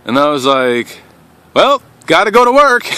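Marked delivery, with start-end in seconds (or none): 1.5-2.8 awkward breezy excuse. Retold or imitated. Awkward morning excuse quoted.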